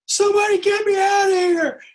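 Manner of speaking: very high, strained panicked scream; acted screech of desperation, pitch shooting up; panicked freak-out. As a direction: cry out for rescue in a panic. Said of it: "I start freaking out".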